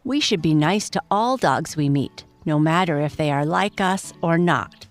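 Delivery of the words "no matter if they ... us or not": ordinary delivery, nothing marked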